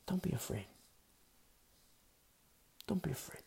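quietly calm their fear; soft reassurance. Real, not acted. Reassuring listeners after pause.

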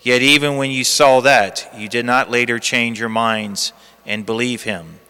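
low, hushed Gospel reading; cathedral echo. Acted. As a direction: read the Gospel solemnly, like in church. Liturgical Gospel reading at the lectern.

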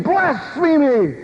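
slow, high drawn-out accusing yell; high priest condemning him with a drawn-out high cry; Caiaphas screaming. Retold or imitated. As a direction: stretch the word out high and outraged. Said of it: "the Caiaphas screaming".

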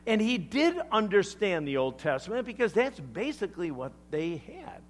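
ordinary delivery

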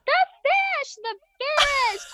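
high panicked yelling of the fish; frantic high yell across the gas station; retold panicked scream. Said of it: "I'm screaming at this gas station".

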